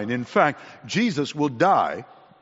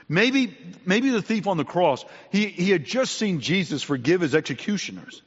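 ordinary delivery

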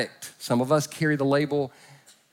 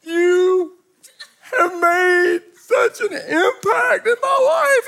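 put-on high, slow, crying drunk confession; drunk sob; 4 dB louder, much slower than usual. Drunk man tearfully tells the pastor of his impact, high and slow. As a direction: blubber it out with a laugh and a sob. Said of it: "he went".

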